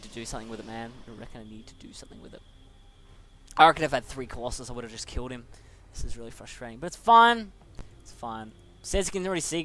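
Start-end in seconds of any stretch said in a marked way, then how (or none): 1.1-2.4 much softer, under his breath, quick; quiet frustrated repeat; muttered to himself. Real, not acted. 6.8-7.5 higher and louder, forced "fine"; sarcastic loud reassurance; loud exasperated. Real, not acted. Frustrated after a loss. 8.0-8.5 much softer, dropping away; deflated under-the-breath echo; quiet resigned. Real, not acted. Frustrated after a loss.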